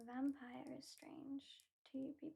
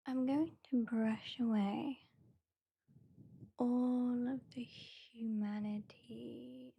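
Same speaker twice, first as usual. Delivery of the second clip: mock menace; 12 dB louder, slower than usual. Mock threat, then "just kidding.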